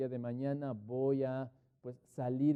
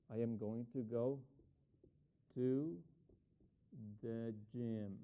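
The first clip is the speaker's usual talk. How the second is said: "I am going to go to the gym" built up slowly with long pauses; slow dictation; 4 semitones lower, 4 dB quieter, much slower than usual. Shown as a demonstration. Teacher answering Estefanía, dictating the plan slowly. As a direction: dictate the plan word by word, repeating as you go. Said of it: "Entonces digo".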